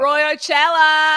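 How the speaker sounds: loud, high, drawn-out whoop; excited cheer. Real, not acted. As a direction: call it out like a festival crowd. Cheering the festival nickname.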